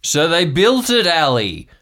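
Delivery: an uncle sure of his wild theory; excited conspiracy uncle. Acted. [name] plays uncle Marv.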